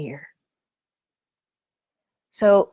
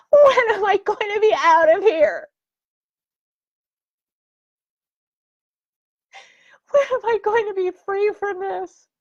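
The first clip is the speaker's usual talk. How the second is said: higher, breaking with tears; desperate crying; 11 semitones higher, 4 dB louder than usual. Retold or imitated. Speaker reliving childhood despair, crying out to escape. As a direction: plead through a cry to escape. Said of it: "when I was counting the days".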